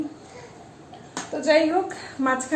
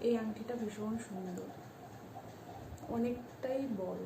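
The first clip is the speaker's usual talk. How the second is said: speaking softly; 16 dB quieter than usual. Real, not acted. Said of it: "আমি এই জন্য আস্তে আস্তে কথা বলছিলাম".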